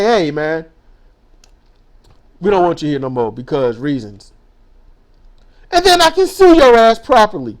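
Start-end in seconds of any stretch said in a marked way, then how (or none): 0.0-4.3 much lower, quieter mock boss voice, dragged out; mock blunt boss. Ranting vlogger mimics a boss firing him, in a low mock voice. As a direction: drop deep and flat like a boss brushing you off. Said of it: "go up to 'em and say".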